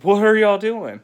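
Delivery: high, loud alarmed shout; startled yell. Retold or imitated. Dad catching kids in the litter box, loud high shout. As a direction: shout across the room in alarm.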